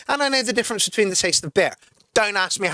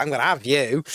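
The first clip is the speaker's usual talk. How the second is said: intimidating threat; 6 semitones lower than usual. Shown as a demonstration. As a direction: say it low and threatening. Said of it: "you can still be intimidating".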